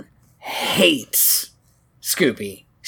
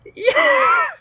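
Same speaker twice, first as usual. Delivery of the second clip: excited shout; 3 dB louder than usual. Real, not acted. Excited cheer at Mark Hamill reveal.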